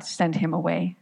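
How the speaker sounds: ordinary delivery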